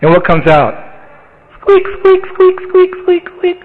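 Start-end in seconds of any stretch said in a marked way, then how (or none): 1.6-3.6 very high tiny squeaks; mouse squeaks. Acted. The great mountain gives birth, tiny high mouse squeaks. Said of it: "A little mouse".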